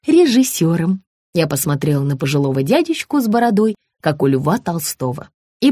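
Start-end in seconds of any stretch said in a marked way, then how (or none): 0.1-1.0 high-pitched sugary voice; high fawning woman. A narrator's reading. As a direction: gush like an eager hostess. Margarita's voice, high.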